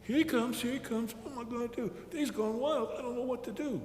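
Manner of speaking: soft worried self-talk; muttering to himself. A narrator's reading. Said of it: "He was muttering to himself".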